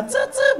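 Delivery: high-pitched cricket noise; imitated cricket chirp. Sleepless man copying the crickets he hears all night, high chirps. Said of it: "כל הלילה אני שומע".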